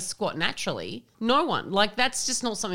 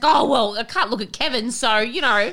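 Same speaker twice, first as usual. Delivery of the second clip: higher, louder prim mum voice; mum excusing herself; 6 semitones higher, 5 dB louder than usual. Retold or imitated. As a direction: brush it off primly as Mum. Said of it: "she's like".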